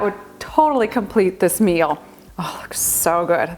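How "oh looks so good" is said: soft breathy whisper of delight. Real, not acted. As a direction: murmur it, marveling at the food. Admiring the soup up close.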